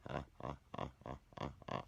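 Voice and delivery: dry mocking ha-ha, each one separate; mock laugh. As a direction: gloat with a deliberate, forced laugh. Gloating over who's laughing now.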